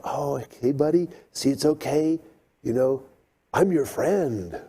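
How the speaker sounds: soothing murmur. Retold or imitated. Boy soothes the tamed lizard in his hand, soft and coaxing. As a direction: hush it like calming a small animal.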